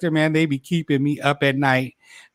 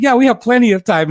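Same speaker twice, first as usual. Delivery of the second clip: cheerful; 7 semitones higher, 6 dB louder than usual. Retold or imitated. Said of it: "I said".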